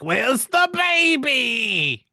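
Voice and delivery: high, loud, drawn-out cartoon yell; mock outraged shout. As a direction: bellow it slowly in hammed-up outrage. Acts villains finding baby gone.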